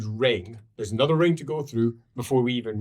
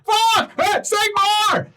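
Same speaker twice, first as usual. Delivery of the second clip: very high, loud desperate shout, slow; a guard calling on his god as the wall falls; panicked cry; 7 dB louder, slower than usual. Acted.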